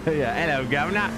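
cockney chimney sweep. Acted. Streamer jokes in a chimney sweep cockney accent. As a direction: say "hello, governor" like an old London chimney sweep. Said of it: "the old chimney sweep accent".

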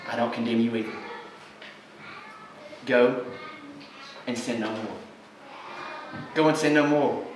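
low, soft tender command; quietly forgiving Jesus; gentle Jesus. Acted. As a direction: speak it softly to the woman. Jesus quoted softly.

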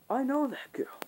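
soft, high put-on dad voice; voiced dad. Cousin's dad recognising the girl, put-on voice.

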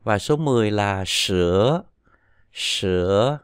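sữa low then dipping; sữa for ten. Shown as a demonstration. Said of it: "đọc gần như ưa là sữa".

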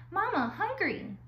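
high, small peeping gosling voice; baby gosling voice. Acted. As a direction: squeak it like a hungry baby bird.

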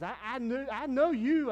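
very high, loud incredulous protest; incredulous friend. Retold or imitated. Old friend protests he knows the pastor's past, very high and loud. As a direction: insist you know who he really was. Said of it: "He goes, he goes".

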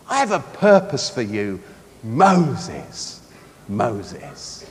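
low, slow, tender voice; loving God. Acted. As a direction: draw out the name gently and reassure. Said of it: "God speaks to us out of a love".

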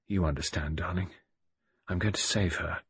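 soft, low private vow; murmured under breath. A narrator's reading. Widower speaking to his dead wife, murmuring under his breath. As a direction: murmur it tenderly, almost to yourself. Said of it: "under my breath, I murmured".

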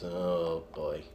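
ordinary delivery

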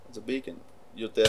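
ordinary talk, nothing marked

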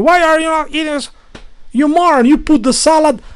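fast, high mocking yell; imitated screaming roommate. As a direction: ham up his shrill nagging, fast and high. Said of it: "he come screaming".

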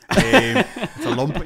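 laughing loudly through "it's a lump", slowed right down. Real, not acted. Retelling the lump joke to friends, laughing loudly. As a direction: lose it mid-line, laughing hard.

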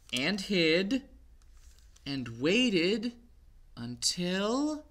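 lively picture-book read-aloud; story reading to children. A narrator's reading. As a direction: read it to little ones.

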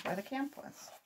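plain reading voice

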